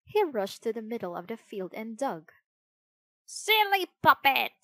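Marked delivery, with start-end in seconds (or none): 3.2-4.5 high, loud squawking parrot voice. Acted. Parrot jeering at the digging puppet, squawking voice. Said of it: "squawked a parrot".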